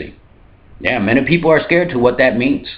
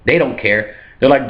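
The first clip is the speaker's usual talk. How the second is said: normal talking voice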